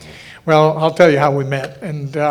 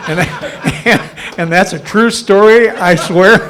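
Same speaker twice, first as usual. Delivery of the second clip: louder, laughing through the words; 4 semitones higher, 6 dB louder, slower than usual. Real, not acted. Speaker insisting to the audience the story is true, laughing. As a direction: still laughing as you swear it's true. Still laughing after punchline.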